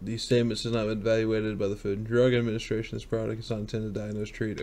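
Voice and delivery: fast, soft small-print reading; rushed disclaimer. Real, not acted. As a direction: rattle it off quietly like fine print. Skims the legal disclaimer aloud.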